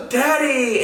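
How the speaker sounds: sharp gasp, then high "Daddy!"; relieved child's cry. Retold or imitated. Lost daughter spots her dad at the neighbors, relieved cry. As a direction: imitate a little girl's high, excited cry.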